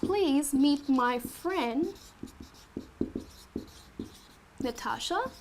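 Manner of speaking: slow, quiet model sentence; slow and soft. Shown as a demonstration. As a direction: say it slowly and gently for learners.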